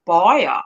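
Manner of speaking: buyer with a rounded, covered vowel, the mouth held tight; hot potato accent. Shown as a demonstration. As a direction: say buyer as if someone holds your mouth. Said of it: "holding their mouth like this".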